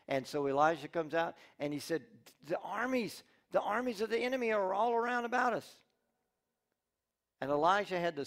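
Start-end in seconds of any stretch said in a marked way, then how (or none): 2.4-5.8 higher pitched and urgent; alarmed report. Acted. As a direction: tell it with rising alarm. Servant reporting surrounding army.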